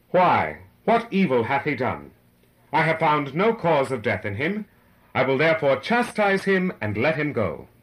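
loud, firm public pronouncement. A narrator's reading. Pilate tries a third time to save him, insistent and loud.

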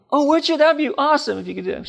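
high, eager, quick voice of a delighted woman; grateful lady. Retold or imitated. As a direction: play the bedridden lady lighting up. Sick lady answers gratefully.

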